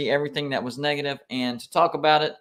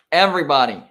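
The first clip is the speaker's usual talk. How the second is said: sudden louder exasperated Everybody; annoyed outburst; 8 dB louder, faster than usual. Real, not acted. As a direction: bark it out in disbelief.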